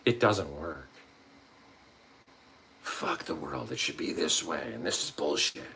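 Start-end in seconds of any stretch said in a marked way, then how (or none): 2.8-5.6 bitter grumble. Retold or imitated.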